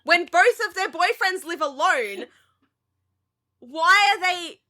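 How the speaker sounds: loud, high, baffled outburst; exasperated rant. Real, not acted. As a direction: vent at the screen in disbelief. Ranting about Friends roommates.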